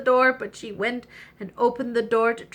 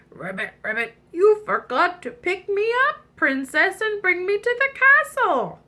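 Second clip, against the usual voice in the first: pleading frog voice; 9 semitones higher, 4 dB louder than usual. Acted. Frog asks to be carried.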